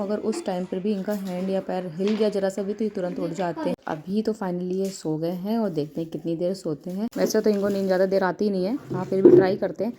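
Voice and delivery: ordinary delivery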